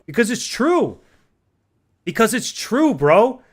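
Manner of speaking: high, loud angry ranting; furious rant. Real, not acted. Ranting to gaming friends about war spending, furious. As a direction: hammer each point home, furious.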